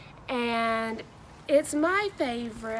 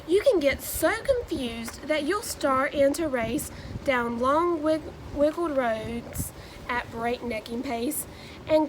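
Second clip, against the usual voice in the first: lively, sing-song picture-book read-aloud; animated Dr. Seuss storytelling with rhyming bounce; reading to children. Acted. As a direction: read it like story time for a class of kids.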